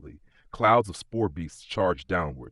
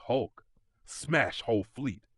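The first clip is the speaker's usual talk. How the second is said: plain reading voice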